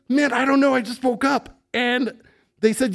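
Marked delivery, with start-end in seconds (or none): 0.1-1.6 sheepish self. Retold or imitated. Himself explaining sheepishly.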